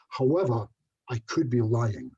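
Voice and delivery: stage pause before the punchline; dramatic pause. Shown as a demonstration. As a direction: hold a beat after However, then deliver the twist slowly. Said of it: "I will pause, look at my audience".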